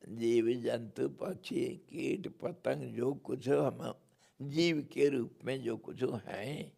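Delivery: normal talking voice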